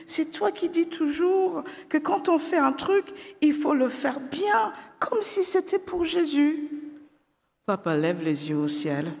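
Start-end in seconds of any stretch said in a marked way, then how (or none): 0.1-6.7 put-on high child's voice, close to tears; pleading girl. A narrator's reading. Daughter reminds father of his rule, tearful. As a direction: plead like a hurt little girl.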